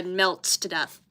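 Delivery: usual speaking voice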